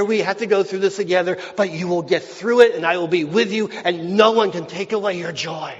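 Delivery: loud, driving pulpit preaching; full-force preaching. Acted. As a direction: preach it with a ringing, confident voice. Preaching grief and promise.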